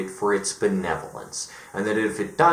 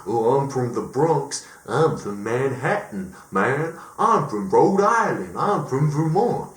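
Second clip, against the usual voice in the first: hammed-up East Coast regional accents, drawn out; mock regional accents; 3 semitones higher than usual. Mocking people who boast of regional accents, exaggerated East Coast accents. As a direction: parody locals announcing their hometown accents. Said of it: "New York has a different accent".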